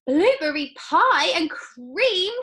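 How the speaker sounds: high, loud, slow delight; shouted. Acted. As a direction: announce dessert with glee. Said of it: "shouted Violet".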